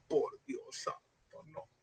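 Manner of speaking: slow whispered dismay; whispered exasperation. Real, not acted. Preacher whispering despair at the six-hour service. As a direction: breathe it out in disbelief. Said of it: "exasperated aside".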